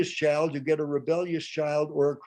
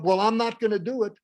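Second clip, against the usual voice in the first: quick, higher-pitched defiant refusal; rebellious child; 7 semitones higher, 4 dB louder, faster than usual. Acted. Rebellious child refusing to help, quick defiance. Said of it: "With rebellious child".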